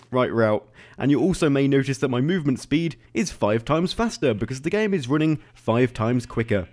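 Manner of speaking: ordinary delivery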